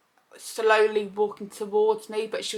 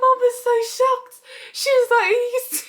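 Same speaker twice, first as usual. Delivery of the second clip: laughing; 5 dB louder than usual. Retold or imitated. Retelling mum's shock, laughing.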